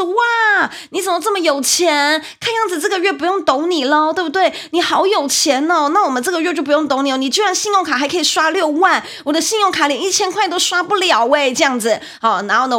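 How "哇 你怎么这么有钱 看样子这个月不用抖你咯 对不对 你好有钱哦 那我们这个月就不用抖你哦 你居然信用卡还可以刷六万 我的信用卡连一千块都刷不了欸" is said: high, loud teasing singsong; mock the streamer like a jeering fan; imitating a teasing viewer, higher and louder. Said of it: "他就是在那边笑说".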